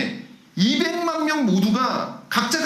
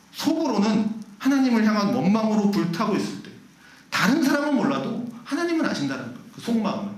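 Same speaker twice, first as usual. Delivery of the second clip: usual speaking voice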